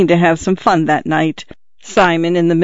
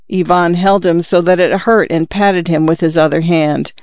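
usual speaking voice

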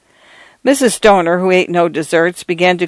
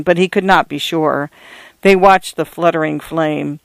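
ordinary delivery, nothing marked

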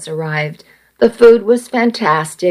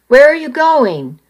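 high, raised call; narrated shout; 7 semitones higher than usual. A narrator's reading. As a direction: call out after her as he jumps from the truck. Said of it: "he shouted".

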